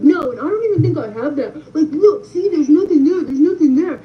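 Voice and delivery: high, loud insistent whine; mock defensive ex. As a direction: insist loudly like a guilty ex. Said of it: "He was like".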